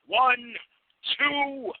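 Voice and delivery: high, stern threatening voice; gruff threatening man. Acted. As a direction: bark warnings at the kid. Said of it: "Russ Dove shoots people in the face".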